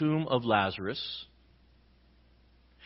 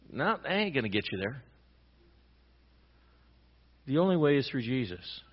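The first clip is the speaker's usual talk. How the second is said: ordinary talk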